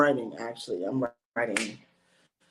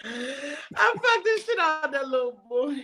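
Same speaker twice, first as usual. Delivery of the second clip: laughing; 6 semitones higher, 7 dB louder than usual. Real, not acted. Punchline cracking up.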